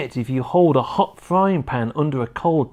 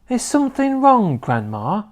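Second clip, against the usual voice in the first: polite question; 8 semitones higher than usual. A narrator's reading. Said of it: "George asked her politely".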